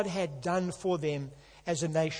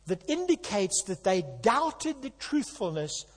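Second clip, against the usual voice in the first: usual speaking voice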